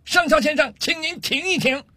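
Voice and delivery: high-pitched call to a superior officer; mock military shout, pitched high; calling out loudly. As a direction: call out across the parade ground, high and drawn out. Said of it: "冲着上校的方向大声喊道".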